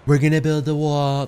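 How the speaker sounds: brief attempt at a Trump impression; tentative political impersonation; Trump accent. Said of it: "I was gonna do a Trump accent".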